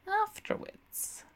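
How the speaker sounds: high, slow, teasing voice; coyly drawn-out afterwards; coy promise. A narrator's reading. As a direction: put him off playfully, sing-song and coy. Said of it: "Miss Juice promised coyly".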